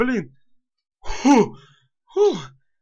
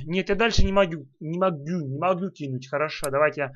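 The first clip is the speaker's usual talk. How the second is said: ordinary delivery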